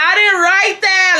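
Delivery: hammed-up crying protest; mock crying. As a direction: wail it like a guilty kid. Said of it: "bitch, I'm crying".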